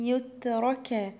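يترك slowly with the taa held still; stopped taa. Shown as a demonstration. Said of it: "حرف التاء هنا ساكن". (تاء ساكنة في يترك)

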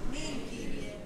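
soft whispered reply; whispered amen. Real, not acted. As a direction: breathe the amen. Quiet response to question. (ψιθυριστό αμήν)

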